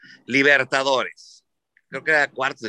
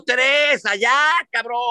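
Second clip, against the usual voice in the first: triumphant taunt; 11 semitones higher, 4 dB louder, slower than usual. Retold or imitated. Crowing over his three goals.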